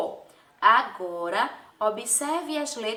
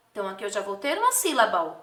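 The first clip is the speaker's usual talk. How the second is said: normal talking voice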